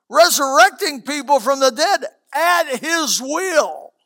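high, loud preaching rebuke; preacher at full force, scolding the congregation. Acted. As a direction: thunder it from the pulpit.

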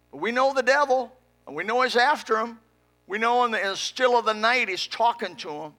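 impassioned pulpit prayer; fervent prayer. Real, not acted. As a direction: plead it high and forceful. Fervent prayer against the devil.

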